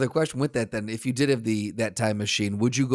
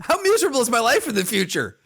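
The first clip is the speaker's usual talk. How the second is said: high-pitched comic lament; mock despair; 10 semitones higher, 3 dB louder than usual. Past self questioning his future visitor, high mock despair. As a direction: wail the question in mock dismay.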